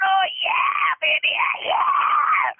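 ecstatic high shout, mimicking the singer; wild falsetto whoop of delight; freaking out. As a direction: squeal it like a thrilled rocker. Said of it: "he's freaking out".

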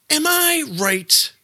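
high mock voice. Teasing high put-on question.

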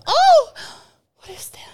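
high startled yelp, then a hushed question. Pretend. Woman acting out a toy switching on in a store, startled. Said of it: "they go".

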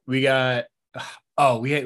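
ordinary talk

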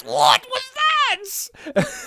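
very high, very slow stunned "What was that?"; Bartek's funny voice. Retold or imitated. Bartek reacting to a crazy movie moment, high and drawn out. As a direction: stretch it out in a high squeaky disbelief. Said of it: "Bartek's voice goes real funny".

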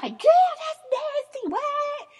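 very high, squealing disgust; grossed-out friends. Retold or imitated. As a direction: play the friends recoiling. Friends' disgusted reply voiced.

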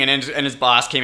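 ordinary talk, nothing marked

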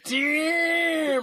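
high shouted prophet cry; shouted doom. Retold or imitated. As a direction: bellow the doom. Echoing doom line.

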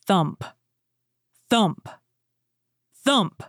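spaced thuds, each climbing in pitch; heavy footsteps. A narrator's reading. Ogre coming nearer, heavy ominous thumps. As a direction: land each word like a giant's tread.

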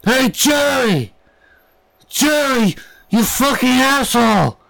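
high, slow, drawn-out calling voice; a stranger creeping up at the gas station, calling out; creepy stranger. Retold or imitated. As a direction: hail a stranger like an old buddy. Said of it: "this guy creeps up behind me and goes".

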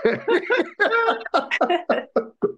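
big laugh. Real, not acted. Laughing at the tale of the model's rejection, big laugh. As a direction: laugh hard at your own story.

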